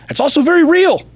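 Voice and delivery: sudden high, loud push on real; intense retold pep talk. Retelling his pep talk to son.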